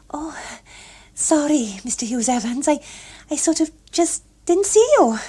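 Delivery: put-on high, flustered girl's voice; flustered young woman. A narrator's reading. Gloria apologising to the older man, high and flustered. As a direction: stammer it out, caught off guard.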